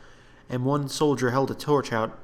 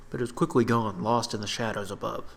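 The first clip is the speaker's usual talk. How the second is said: usual speaking voice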